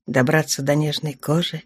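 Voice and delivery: plain reading voice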